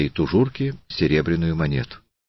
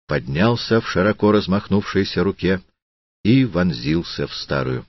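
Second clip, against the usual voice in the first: ordinary delivery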